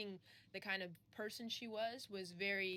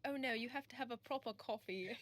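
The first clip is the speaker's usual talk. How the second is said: put-on quick try at a posh British accent; lightly put-on British accent; 3 semitones higher than usual. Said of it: "someone said to me".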